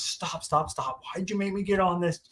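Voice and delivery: high, hushed imitation of frantic protesting; mock angry pleading. Husband blaming his wife on the ride, mock angry. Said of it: "he was so mad".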